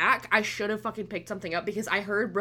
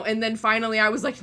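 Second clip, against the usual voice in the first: normal talking voice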